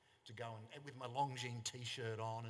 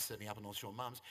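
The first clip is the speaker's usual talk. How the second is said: ordinary delivery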